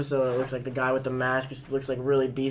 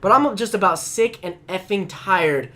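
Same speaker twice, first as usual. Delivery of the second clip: exasperated rant; 5 semitones higher, 6 dB louder than usual. Real, not acted. Ranting about high school anime.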